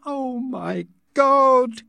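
slow, high anguished wail; crying out. A narrator's reading. Savage kneeling by dead Linda, anguished. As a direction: draw it out in grief, pitch high. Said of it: "began crying loudly".

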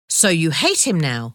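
ordinary delivery, nothing marked

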